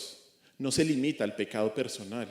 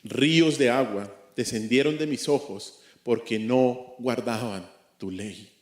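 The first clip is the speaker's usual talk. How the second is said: slow scripture reading; 2 semitones lower, 4 dB louder than usual. Shown as a demonstration. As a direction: read the verse slowly and weightily, with a lament in it. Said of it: "Dice así".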